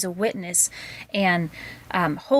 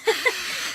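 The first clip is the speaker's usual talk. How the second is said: high airy giggle; breathy laugh. Real, not acted. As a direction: laugh off the compliment. Reacting to being praised.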